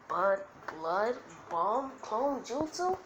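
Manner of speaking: slow, questioning repeat; puzzled echo. Acted. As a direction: sound out the move in doubt. Acted puzzled repeat of move.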